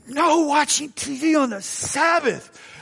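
high, slow, prissy church-lady rule; prim scold. Retold or imitated. Date forbids TV on Sabbath, high prim slow voice. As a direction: lay down the law primly and slowly. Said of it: "She was so upset".

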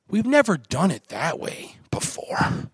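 slow, whispery objector voice; disapproving old-timer. Acted. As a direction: draw it out in a hushed, put-on voice. Acts out a traditional objector.

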